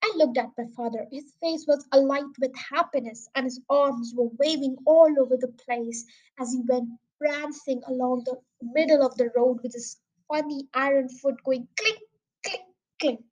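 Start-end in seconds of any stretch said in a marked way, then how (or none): none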